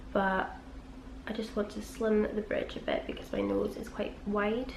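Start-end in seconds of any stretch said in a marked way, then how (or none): none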